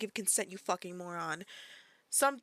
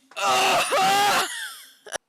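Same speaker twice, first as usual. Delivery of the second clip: loud, high drawn-out wail; wailing outburst; 10 semitones higher, 9 dB louder than usual. Real, not acted. Breaking off mid-sentence while reacting, wailing outburst.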